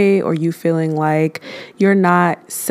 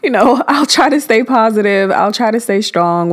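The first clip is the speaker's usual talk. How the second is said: high, laughing, mock-positive reply; forced brave face; 5 semitones higher, 3 dB louder than usual. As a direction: laugh it off while pretending to stay upbeat. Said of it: "So I'm just like".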